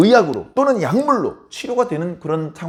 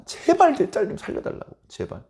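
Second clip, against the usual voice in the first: desperate plea; 7 semitones higher, 5 dB quieter than usual. Acted. Mother begging Jesus.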